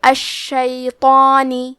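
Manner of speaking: "الشیطانی" with a doubled shin and a heavy, thick ta and long alif; heavy madd alif. Shown as a demonstration. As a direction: press the shin and keep "طا" full and heavy. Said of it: "تو ہمیں مد الف کو بھی بھاری آواز میں پڑھنا ہے". ("الشیطانی" میں شین پر تشدید اور طا کو بھاری آواز میں)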